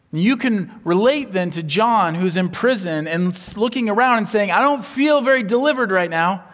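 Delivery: loud, high impassioned preaching; full-force preaching. Acted. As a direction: preach it out to the whole hall.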